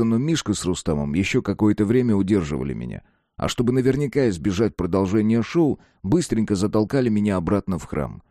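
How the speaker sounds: usual speaking voice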